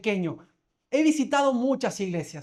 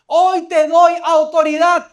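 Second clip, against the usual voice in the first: loud, high, slow preaching declaration; forceful proclamation; 6 semitones higher, 12 dB louder, slower than usual. Retold or imitated. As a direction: thunder it out slowly as God's decree. Preacher proclaims God's words to congregation.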